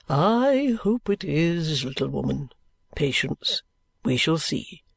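put-on low, slow, measured older man; thoughtful guardian, drawing out the first words; kindly guardian. A narrator's reading. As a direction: answer gravely and patiently.

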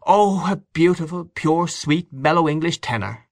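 put-on high, rapturous old aunt's voice; Aunt Kate. A narrator's reading. Aunt Kate rhapsodises about her tenor, high and fond. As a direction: savour each word of praise.

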